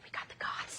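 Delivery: hushed, whispered mock triumph; awed whisper. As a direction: whisper it like a secret victory. Acts out her awed private reaction.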